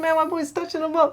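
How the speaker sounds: high mocking coach voice; hyped trash-talking coach impression. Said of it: "he's like".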